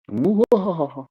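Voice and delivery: sneering villain cackle, high and loud; Doctor Evil laugh. Pretend. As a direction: mwahaha like a panto villain. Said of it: "you gotta do the Doctor Evil, Evil laugh with your pinky up".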